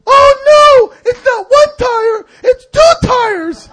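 very high, loud, drawn-out shriek; hammed-up distressed yelling; wailing yell. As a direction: wail each word slowly in despair. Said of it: "another yell".

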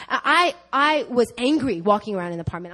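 ordinary talk

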